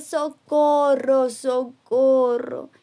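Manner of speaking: loud, drawn-out call; cry for help. Acted. Father calling loudly and slowly for help again. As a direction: shout slowly for rescue.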